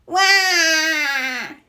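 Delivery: cartoon crying wah; toddler wail. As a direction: bawl like an upset little piglet. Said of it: "he cries".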